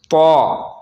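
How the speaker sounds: तौ with a thick t and rounded aw; heavy to. Shown as a demonstration. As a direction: fill out तौ, long and back. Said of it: "पुर पढ़ेंगे".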